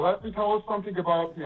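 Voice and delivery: usual speaking voice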